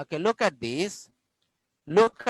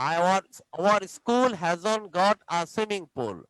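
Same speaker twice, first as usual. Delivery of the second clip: very slow, each word separate; slow model reading; much slower than usual. Shown as a demonstration. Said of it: "teacher repeats slowly".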